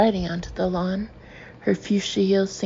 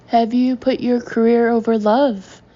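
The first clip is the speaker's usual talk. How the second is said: higher, louder eager call; bright, loud question; 3 semitones higher, 7 dB louder than usual. A narrator's reading. Girl reporter to Samantha, eager and louder. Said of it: "chimes in a bright-faced girl".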